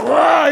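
loud fierce "Rah!" roar; mom's battle roar. Retold or imitated. Mom tackling dad, a fierce battle roar. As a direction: roar like she's taking him down. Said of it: "She went".

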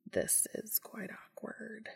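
slow hushed whisper. Acted. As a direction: murmur it slowly, stunned. Castiel whispers in shock.

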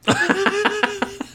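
high, delighted laugh bursting out; sudden amused laugh at the news; burst of laughter. Real, not acted. Co-host hears bigger credits.

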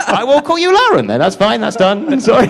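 high, hurried giving in with laughter; mock-alarmed climbdown. Real, not acted. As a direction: squeal a jokey apology, laughing. Backing down after jokey warning.

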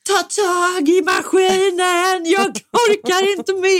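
slow, strained high cry for help; desperate high yell. Retold or imitated. Holding the washer alone, slow desperate high yell. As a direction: plead loudly while straining.

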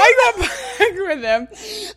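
high, slow, laughing confession; laughing admission. Real, not acted. Admitting to co-host she took him back, laughing. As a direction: squeal it through embarrassed laughter.

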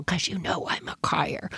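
soft unvoiced confiding aside; whispered aside. Real, not acted. Confiding to the viewers in a whisper. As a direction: let them in on a secret in a whisper.

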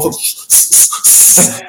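a breathless whisper, slowed right down; whispering so the coach won't hear; hushed whisper. Retold or imitated. Players go silent as Jeff walks on, whispered.

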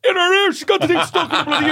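fast, very high mock holler; loud exclamation. Other steward announcing the stuck earbud, loud mock exclamation. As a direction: broadcast it to the entire cabin.

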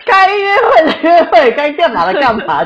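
high, loud flustered laugh. Real, not acted. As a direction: laugh through the words embarrassed. (边笑边解释)

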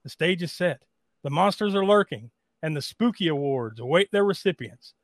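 dramatic scripted intro read; awards show host. Acted. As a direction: read it like a spooky emcee.

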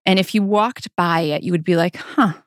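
normal talking voice

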